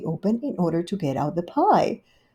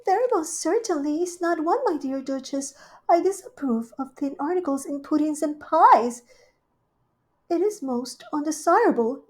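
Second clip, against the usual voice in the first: Rivy's voice; 9 semitones higher than usual. Acted. Rivy welcoming Duchess, high gushing hostess. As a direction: greet her sweetly and grandly.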